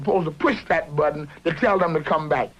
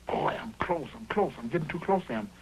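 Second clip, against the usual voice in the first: softer imitation of a negotiator; quoted negotiator; 3 semitones lower, 8 dB quieter than usual. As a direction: quote him quietly, a touch lower. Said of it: "this other guy say".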